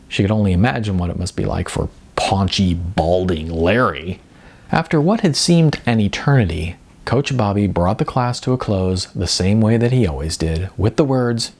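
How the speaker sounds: ordinary delivery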